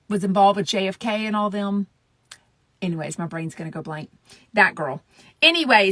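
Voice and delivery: usual speaking voice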